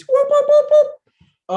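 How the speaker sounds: a piercing, high, loud whooping siren; police siren imitation. Telling viewers he heard police, a loud whooping siren imitation. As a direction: imitate the cop car whoop as loud as you can. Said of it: "heard the police".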